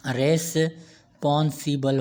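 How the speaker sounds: रेस्पॉन्सिबल slowly split as res, pon-si-bal; syllable by syllable. Shown as a demonstration. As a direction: break रेस्पॉन्सिबल after the first syllable. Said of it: "many movements के साथ".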